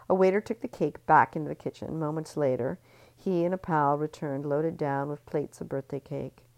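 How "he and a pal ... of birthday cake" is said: usual speaking voice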